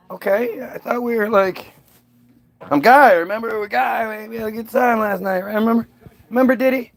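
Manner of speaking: high hopeful coaxing; pleading. Pretend. Pleading [name] remember him.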